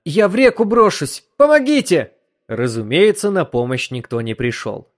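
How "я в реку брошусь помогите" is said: high, loud cry for help; panicked scream. A narrator's reading. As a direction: scream in terror. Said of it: "крикнула она".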